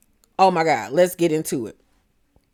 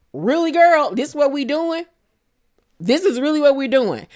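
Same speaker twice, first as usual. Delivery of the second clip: indignant disbelief; 7 semitones higher than usual. Pretend. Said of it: "I would have been mad too".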